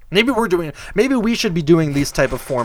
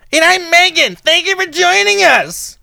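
much higher, louder sweet host voice; mock Meghan; 13 semitones higher, 5 dB louder than usual. Host mocking Meghan's podcast intro, high gushing mock voice. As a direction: gush it like a polished show host. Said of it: "That's how they start".

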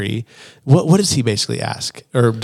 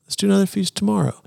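Esther's suggestion; 8 semitones higher, 4 dB quieter, faster than usual. Retold or imitated. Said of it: "Esther was like".

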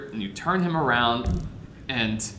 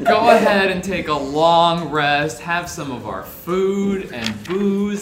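generous goblin host; 5 semitones higher, 8 dB louder, slower than usual. Retold or imitated. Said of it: "he was like".

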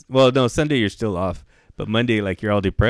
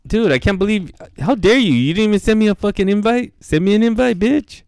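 high-pitched indignant complaint; mock outrage; 9 semitones higher than usual. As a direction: act hugely offended at being snubbed, pitched well up. Mock outrage at missing invite.

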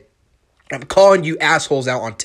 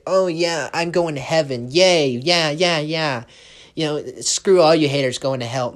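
higher, smug, gloating sing-song; cocky mock gloat; 3 semitones higher, 4 dB louder than usual. Podcaster mocking a cocky believer, smug gloating voice. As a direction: ham up a smug know-it-all bragging about heaven. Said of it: "be cocky and say".

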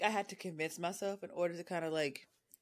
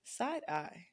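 soft teasing whisper; whispered aside; 10 dB quieter than usual. Real, not acted. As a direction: whisper it as a sly little aside. Teasing aside after friend's attempts.

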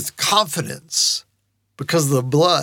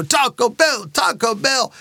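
high, insistent mock chant; kids crying out; 6 semitones higher, slower than usual. Kids pester the pastor after church, mock chanting. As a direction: imitate children clamoring in a higher voice. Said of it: "they were crying it out louder and louder".

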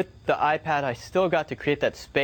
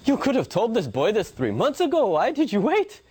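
perplexed apprentice; 8 semitones higher than usual. Retold or imitated.

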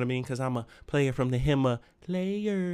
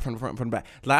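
ordinary delivery

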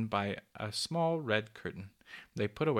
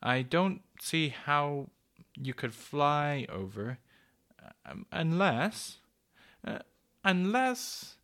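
slow, drawn-out pondering, rising at the end; thoughtful; 4 semitones higher, 3 dB louder, slower than usual. A narrator's reading. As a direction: feel your way haltingly towards an idea. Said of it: "said the cuckoo thoughtfully".

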